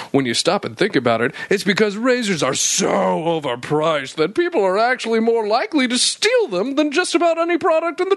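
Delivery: upbeat sponsor read. Acted. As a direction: pitch it like a host ad. Reads ad copy.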